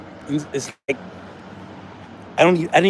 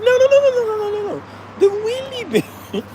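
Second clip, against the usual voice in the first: much higher, hammed-up British correction; mock British waitress. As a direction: play the prim British waitress, high. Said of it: "She was like".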